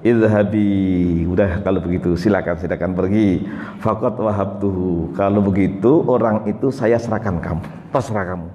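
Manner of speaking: God replying to the sura, deep and calm; God answering. Acted. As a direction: grant the request with low gentle authority. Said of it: "Allah menjawab".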